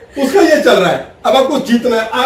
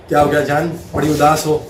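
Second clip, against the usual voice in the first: tender coaxing; 6 semitones lower than usual. Retold or imitated. Husband coaxing sulking wife.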